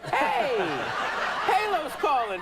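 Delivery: high-pitched, delighted caller impression; happy and excited. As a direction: imitate a caller thrilled to announce himself. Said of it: "he sounded really happy and excited".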